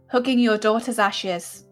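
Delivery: ordinary delivery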